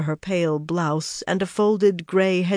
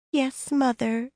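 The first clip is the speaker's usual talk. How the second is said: high, soft, drawn-out sugary voice; sickly sweet; 8 semitones higher, 4 dB quieter, slower than usual. A narrator's reading. Elaine answers her fussing friend like a mother, sickly sweet. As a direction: lay the sweetness on thick, high and slow. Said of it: "said in a sickly sweet voice".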